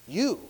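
"You" pitched high and set apart; stress on YOU. Shown as a demonstration. Preacher repeating the emphatic Greek pronoun, "You" stressed. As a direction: lift "You" and pause after it. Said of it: "There's an emphatic you".

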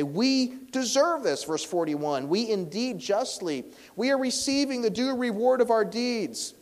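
loud, high, slow proclaiming of the confession; full-force preaching. Acted. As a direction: cry it out to the crowd. Preaching the criminal's confession loudly.

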